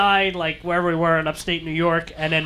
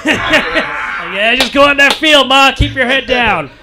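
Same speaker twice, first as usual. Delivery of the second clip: mock coaxing; 6 semitones higher, 6 dB louder, slower than usual. Mock-coaxing mother-in-law to field.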